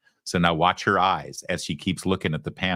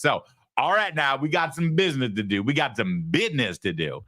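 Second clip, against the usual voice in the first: imitated semi-urban Detroit accent, starting high and falling; mock a candidate forcing a Detroit accent; put-on Detroit accent, mildly exaggerated; 4 semitones higher than usual. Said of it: "So she's trying the accent again".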